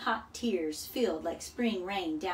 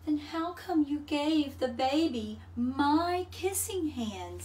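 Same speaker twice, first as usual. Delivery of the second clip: put-on hurt child voice; 7 semitones higher than usual. A narrator's reading. Hurt child raccoon asks.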